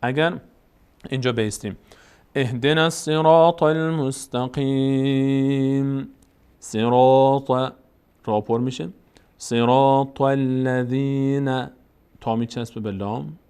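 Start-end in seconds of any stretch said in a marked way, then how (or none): 2.3-7.5 slow, sustained tajweed chant; Quran recitation. Shown as a demonstration. Teacher models the verse for learners, slow measured recitation. 9.4-11.7 slow, sustained tajweed chant; Quran recitation. Shown as a demonstration. Teacher models the verse for learners, slow measured recitation.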